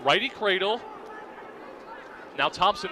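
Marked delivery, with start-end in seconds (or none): none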